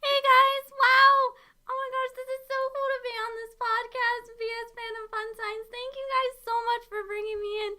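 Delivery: high, gushing, excited character voice; Ruby Rose. Acted. Ruby Rose thanks the podcast hosts, high and gushing. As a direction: squeal hello as a high-voiced, quick-talking guest. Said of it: "I can do a really good Ruby impression".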